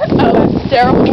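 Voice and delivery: ordinary delivery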